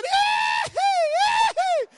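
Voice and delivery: very high, very loud teasing squeals; taunting squeal. Retold or imitated. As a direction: squeal in the boy's face after the goal. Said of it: "Rebola na frente do menino". (gritinhos provocadores, muito agudos e altos)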